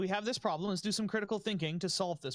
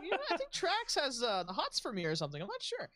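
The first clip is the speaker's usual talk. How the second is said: high, giggly gossip voice; mock coworker gossip; 6 semitones higher than usual. Friend voicing the coworker gossiping, high and giggly. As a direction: gossip coyly, half laughing. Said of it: "He's like".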